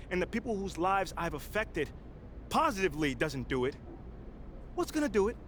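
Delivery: firm public address, building to passionate peaks; press conference speech. Acted. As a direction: make your case to the reporters, rising as you push. Player speaks at press conference.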